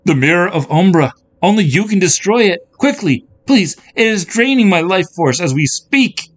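high, loud, urgent princess voice; desperate plea. A narrator's reading. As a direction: beg her to act at once. Weakening princess urgently begs.

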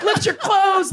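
high, laughing sneer; mocking heckler. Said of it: "he was like".

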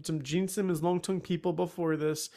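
ordinary talk

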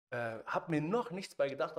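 ordinary talk

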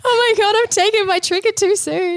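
high, near-sobbing wail of panic; tearful panic. Retold or imitated. To the nurse on the phone, a high tearful panicked wail. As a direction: squeak it out in a teary panic. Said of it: "And I just burst into tears".

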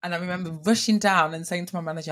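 ordinary talk

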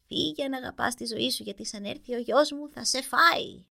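put-on old witch voice. A narrator's reading. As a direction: croak it out like a fairy-tale witch. Witch warns the prince. (τσιριχτή φωνή γριάς μάγισσας)

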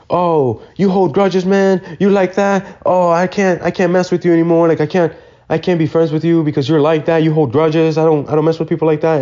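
high-pitched, aggrieved imitation of a coworker; mock accusing. Cook accuses him of holding grudges, mock accusing.

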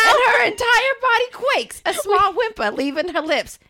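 high, hammed-up Bronx accent; comically bad Bronx accent on the edge of laughter. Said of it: "a Bronx accent".

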